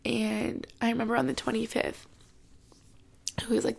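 normal talking voice

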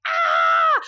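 long mock scream mid-chase; imitated panicked scream. Mother racing after dogs with baby, panicked scream. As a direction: let out a drawn-out "ah" in alarm.

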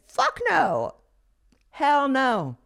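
high-pitched, drawn-out outraged refusal; dramatic high-pitched hard no; emphatic refusal. Retold or imitated. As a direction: refuse it outright, pitch leaping up then falling. Recalled emphatic refusal of that life.

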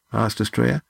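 plain reading voice

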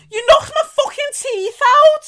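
high, loud laughing outcry; laughing shriek. Real, not acted. Telling her friend about the punch, laughing shriek. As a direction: shriek it through laughter at the memory.

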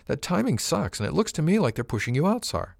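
high-pitched, insistent crewwoman; persistent objection. A narrator's reading. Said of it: "she persisted".

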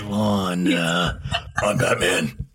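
low Batman rasp, Clint Eastwood style. Acted. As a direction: growl like Batman. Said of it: "That's kinda like Batman".

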